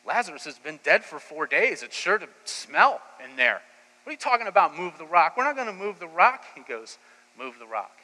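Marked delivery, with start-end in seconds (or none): 0.1-4.8 loud, rising disbelief at the order; incredulous protest. Acted. Crowd balks at Jesus's order, loud incredulous protest. Crowd objects to opening tomb. 4.8-5.4 "rock" thrown back loud and high in disbelief; incredulous outcry. Acted. Crowd repeats Jesus's order, loud and appalled. 5.4-6.4 high, loud flat refusal. Acted. Crowd refuses Jesus at the tomb, loud defiance.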